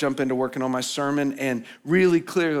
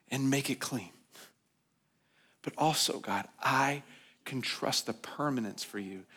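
hushed prayer; 6 dB quieter than usual. Acted. Pastor prays softly before his congregation, hushed prayer. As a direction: pray it quietly, almost to yourself.

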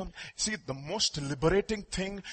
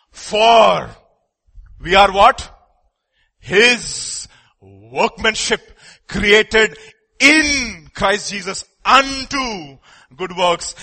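louder, drawn-out scripture reading; loud proclamation; 4 semitones higher, 9 dB louder, much slower than usual. Real, not acted. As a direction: proclaim each word with force. Preacher proclaims scripture loudly.

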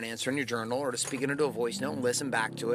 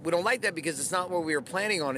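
ordinary talk

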